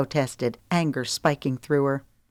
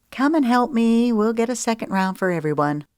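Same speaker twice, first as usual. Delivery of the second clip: higher breezy woman; 6 semitones higher than usual. Acted. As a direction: sing out the offer. Paulette voiced.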